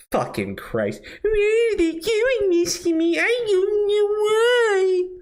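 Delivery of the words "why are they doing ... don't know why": much higher, slow fake-sobbing whine; mock crying whine. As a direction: whimper it like a self-pitying victim. Mocking victim whine, fake crying.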